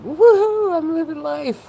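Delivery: imitating her reckless joy on medication; mock whoop. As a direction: cheer it like you're flying down the road. Retelling carefree days on Ativan.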